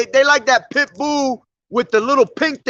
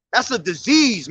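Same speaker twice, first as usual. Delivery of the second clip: ordinary delivery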